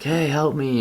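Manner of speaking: mild mock yell, pitched high; retold yell for help. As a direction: call out to passing cars. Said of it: "I'm yelling at them".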